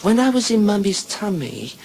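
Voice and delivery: put-on high little-girl storytelling voice; put-on childish treble. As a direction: explain it as a small child, pitched high. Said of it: "She says".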